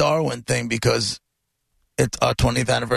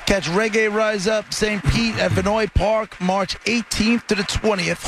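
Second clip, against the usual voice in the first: announcer voice; 6 semitones higher than usual. Real, not acted. Radio host announcing prize.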